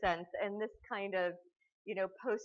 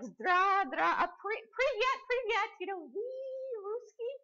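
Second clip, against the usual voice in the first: much higher, stumbling over broken Russian greetings; fumbling bad Russian; 6 dB louder, slower than usual. Lecturer imitating the host greeting soldiers, high fumbling bad Russian. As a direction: squeak out the few Russian words he knows. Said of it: "and he's like".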